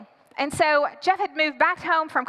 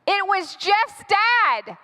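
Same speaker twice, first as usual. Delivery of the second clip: higher, drawn-out dramatic alarm; alarmed reveal; 4 semitones higher, much slower than usual. Acted. Storyteller repeats who is at the door, alarmed. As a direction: stretch it out high in mock horror.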